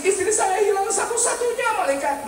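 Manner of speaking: high-pitched hammed-up lament; mock crying. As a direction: plead tearfully to the angel, overdone. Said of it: "Kenapa lagi kamu nangis?". (rengekan sedih yang dibuat-buat)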